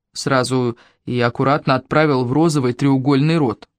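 usual speaking voice

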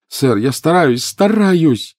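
high, loud, climbing protest; frantic, strained plea; desperate cry. A narrator's reading. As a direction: cry out in despair. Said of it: "отчаянно вскрикнул Гарри".

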